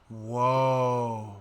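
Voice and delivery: awed. Real, not acted. Gasp at the view.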